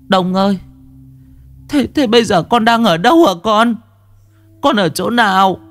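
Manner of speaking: high, tearful pleading; grieving mother. A narrator's reading. Mother asks the spirit where he lies, high tearful plea. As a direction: beg the lost son to come home. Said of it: "Bà hỏi".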